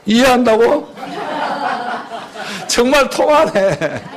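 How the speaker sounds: chuckling through the words; laughing. Real, not acted. Pastor laughing at a congregant's cheeky reply. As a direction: laugh along warmly at the answer.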